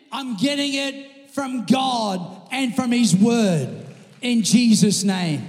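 slow, loud preacher's proclamation; emphatic declaration. Real, not acted. Preacher declares his source to congregation.

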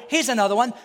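usual speaking voice

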